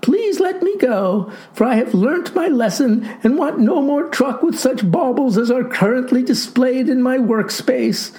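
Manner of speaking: put-on high-pitched, pleading boy; theatrical high lament; wailing. A narrator's reading. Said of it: "wailed the boy".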